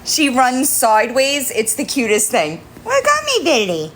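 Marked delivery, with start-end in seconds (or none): 2.8-3.9 high cooing voice to a kitten; squeaky sing-song pet talk. Real, not acted. Baby talk to cat.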